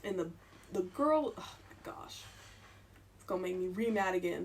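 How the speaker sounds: ordinary talk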